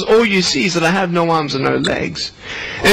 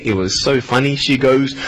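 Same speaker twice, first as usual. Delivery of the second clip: ordinary delivery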